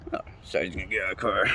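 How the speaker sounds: usual speaking voice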